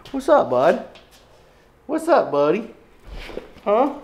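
high pet voice. Real, not acted. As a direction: greet the pup up high. Greeting the dog again.

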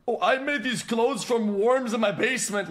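high, prissy mock streamer voice. Ranting streamer mocks a rival's boast in a high mock voice. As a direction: sneer it like a smug influencer. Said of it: "one streamer says".

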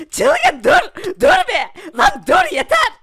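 desperate shouting. Acted. Guard begs Çılgın to stop attacking, shouting. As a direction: scream at him to stop before he kills.

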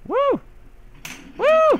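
high gleeful woo; excited whoops. Real, not acted. Gamer whooping at the big desert. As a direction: whoop it up as you ride. Cheering riding through the desert.

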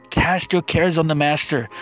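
ordinary talk, nothing marked

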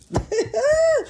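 a short, high burst of uneasy laughter; high nervous laugh. Real, not acted. Reacting to a murder card, high nervous laugh. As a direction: laugh it off in disbelief.

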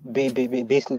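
ordinary talk, nothing marked

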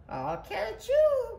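very high, drawn-out call; squealed falsetto cry. A narrator's reading. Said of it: "cried Nellie".

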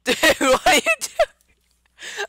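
slow, squeaky laughing words. Real, not acted. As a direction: ask through helpless laughter. Laughing fit begins.

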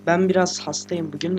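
normal talking voice

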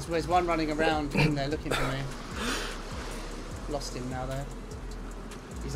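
ordinary delivery

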